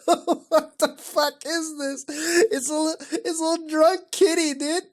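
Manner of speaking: high, squeaky words through laughter; helpless, high-pitched amused disbelief; cracking up. Real, not acted. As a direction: barely get it out for laughing. Cracking up at kitty picture.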